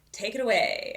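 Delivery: excited bandleader-style shout; hyped-up callout. Retold or imitated. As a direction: call it out big, cueing a solo. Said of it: "how I say these in my head".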